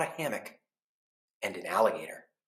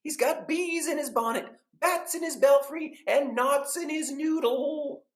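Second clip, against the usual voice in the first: gossiping neighbors; 5 dB louder than usual. Acted. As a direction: act shocked, drawing out each phrase. Neighbors voiced again.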